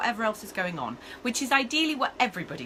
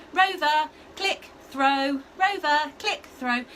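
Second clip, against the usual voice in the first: demo call sequence; 6 semitones higher, 5 dB louder, much slower than usual. Shown as a demonstration. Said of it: "So it looks like".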